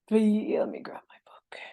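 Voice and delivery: whispered aside, almost silent; whispering. Real, not acted. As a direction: drop to a whisper. Aside while fetching her book.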